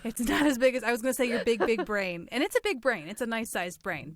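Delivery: normal talking voice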